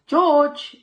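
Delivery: high, loud call across the station. A narrator's reading. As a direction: call him from afar. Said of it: "a familiar voice called".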